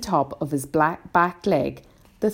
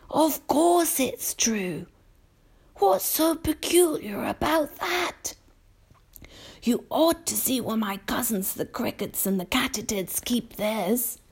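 put-on old grasshopper; 9 semitones higher than usual. A narrator's reading. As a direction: insist mildly, taking your time. Grasshopper insists to the boy.

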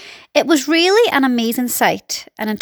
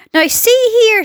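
high, loud call upward; police chief calling sternly; shouted demand; 11 semitones higher, 5 dB louder than usual. A narrator's reading. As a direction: shout it through cupped hands. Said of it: "shouted the chief of police".

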